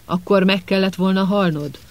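ordinary delivery

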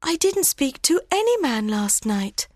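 high-pitched Hero voice, denying it; high, innocent denial; protesting young bride. A narrator's reading. Hero denies the accusation.

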